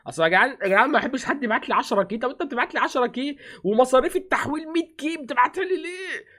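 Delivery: high, rising exasperated complaint to chat; exasperated rant. Real, not acted. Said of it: "انت يا عم بتزعق".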